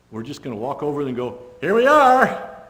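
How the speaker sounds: hammed-up herald calling out; mock cheerful announcement. Preacher mocking soldiers who announce their attack, in a loud bright voice.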